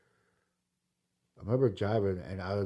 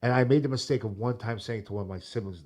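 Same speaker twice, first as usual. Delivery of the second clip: ordinary talk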